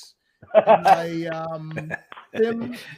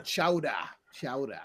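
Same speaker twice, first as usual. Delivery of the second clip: Boston chowder; 6 semitones lower, 6 dB quieter than usual. Shown as a demonstration. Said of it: "[name]'s lost his accent now".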